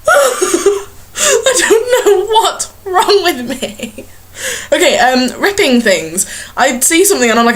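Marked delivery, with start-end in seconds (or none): 0.1-4.1 high, very slow words squeezed out through laughter; laughing through words. Real, not acted. Vlogger laughing while voicing the stunned crowd. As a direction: giggle through every word.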